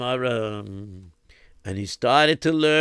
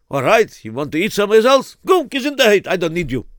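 angry; 7 semitones higher, 6 dB louder, faster than usual. Acted. Lipa angrily dismisses David.

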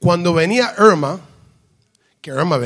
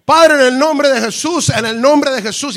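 harder, forceful prayer; 5 semitones higher, 4 dB louder than usual. Retold or imitated. Preacher praying defiantly in secular places, forceful invocation. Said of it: "más duro todavía".

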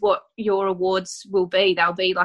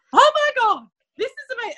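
much higher, squealing with joy; retold overjoyed gasp; 4 dB louder than usual. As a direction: relive the moment of the good news in a high squeal. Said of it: "And I went".

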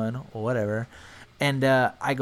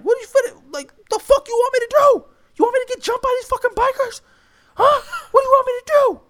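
very high, loud whining yell, fast; whiny yelling; 8 dB louder, faster than usual. Retold or imitated. Friend one whining at friend two, high loud yell. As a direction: plead and yell like near tears. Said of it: "And he was like".

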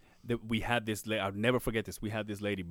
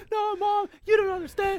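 put-on pitched way up, pleading kid to his mom; whiny teen plea; 6 dB louder than usual. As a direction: whine it high like a desperate kid. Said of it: "I'm like".

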